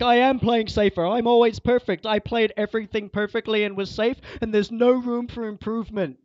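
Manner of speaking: loud, high imitation of defensive protesting; hammed-up indignant self-justification, mimicking the other player; mock defensive. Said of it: "getting defensive all the time".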